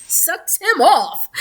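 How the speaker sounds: high, slow words broken by real laughter; can't get it out for laughing; laughing through words. Real, not acted. Laughing while telling the scandal.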